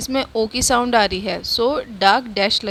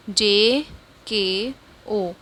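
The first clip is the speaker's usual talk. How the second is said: slow spelling; much slower than usual. Shown as a demonstration. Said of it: "spelling letters for class".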